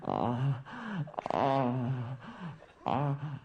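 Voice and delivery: low drawn-out sex moans; mock moaning in bed; moaning noises. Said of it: "you're like".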